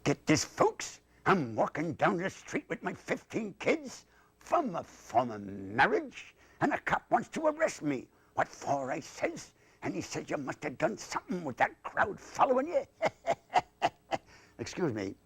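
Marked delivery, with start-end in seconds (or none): 0.0-12.8 high, swooping puppet voice; comic patter with pitch leaping upward; joke-telling dummy. Acted. Dummy tells folks a joke, high swooping comic voice. 12.9-13.9 high, chattering puppet laugh; dummy's cackle. Acted. Dummy laughs at own joke, high cackle.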